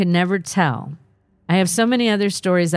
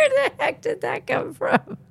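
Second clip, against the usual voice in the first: laughing; 4 dB quieter than usual. Real, not acted. Laughing mid question.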